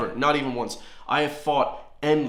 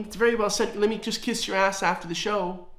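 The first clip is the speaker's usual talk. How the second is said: higher, fawning mock voice; sycophantic journalist; 8 semitones higher than usual. Vlogger mocking the journalist who praised the Taoiseach, higher fawning voice. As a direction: simper it like a brown-noser praising his boss. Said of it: "You know what I mean?".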